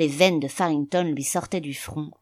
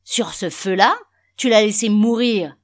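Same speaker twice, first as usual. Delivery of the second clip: loud, raised-pitch outburst; furious; 5 semitones higher, 9 dB louder than usual. A narrator's reading. Father pointing at the dying fire, furiously loud. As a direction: shout the accusation in outrage. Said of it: "L'homme bondit furieusement".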